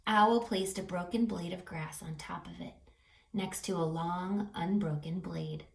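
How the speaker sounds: ordinary delivery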